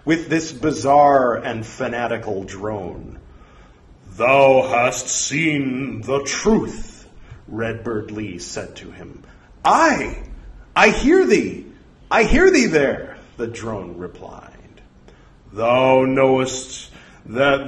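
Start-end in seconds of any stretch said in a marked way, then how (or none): 4.2-7.1 slow, booming archaic pronouncement; stately, drawn-out proclamation; portentous voice from beyond. Acted. 9.6-13.2 high, loud, eager cries; zealous high-pitched devotee; fanatical drone. Acted.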